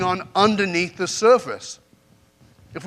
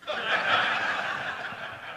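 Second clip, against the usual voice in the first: breathy laugh; 6 semitones higher, 7 dB quieter than usual. Real, not acted. Punchline of his own story.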